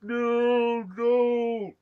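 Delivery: high, loud, strained cry of no; a pained brother crying out; a pained cry of no as his brother is shot; Luke's anguished no. Retold or imitated. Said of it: "Luke is like".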